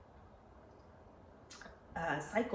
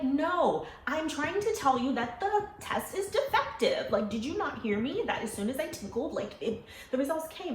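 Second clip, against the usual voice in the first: high, insistent retold objection; exasperated protest; 7 semitones higher, 4 dB louder than usual. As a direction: push back emphatically at the overexcited friend. Retold argument with excited friend.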